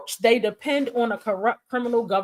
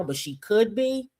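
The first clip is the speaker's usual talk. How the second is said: ordinary delivery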